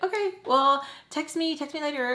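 high-pitched casual sign-off, imitated; oblivious friend. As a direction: say goodbye high, as if nothing's wrong. Imitating oblivious friend's breezy goodbye.

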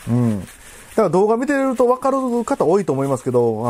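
ordinary talk, nothing marked

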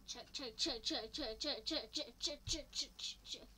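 quick, rhythmic train noise; imitated steam train; train chugging. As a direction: chug along, fading to voiceless puffs. Imitating a train between stops.

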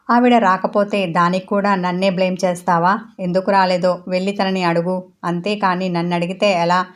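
ordinary delivery

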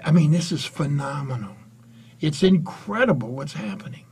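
usual speaking voice

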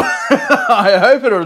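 laughing slowly through it; half-laughing hope. Real, not acted. Repeats it laughing.

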